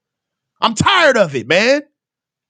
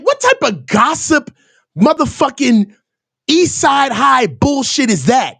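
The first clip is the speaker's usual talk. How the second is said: ordinary talk, nothing marked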